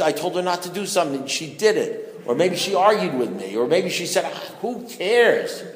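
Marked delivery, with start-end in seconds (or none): 4.2-5.6 high, drawn-out mock brush-off; dismissive retort. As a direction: imitate a wife brushing you off, stretched and high. Retells wife's dismissive answer.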